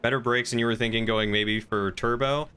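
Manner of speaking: ordinary talk, nothing marked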